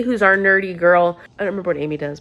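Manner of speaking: ordinary talk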